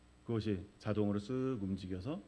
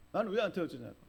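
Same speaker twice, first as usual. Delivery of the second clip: imitated clamour; 7 semitones higher, faster than usual. Left-behind soul protests to those above, mock yelling. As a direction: protest as one of a clamouring crowd. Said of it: "막 귀가 아플 정도로 막 소리를 지릅니다".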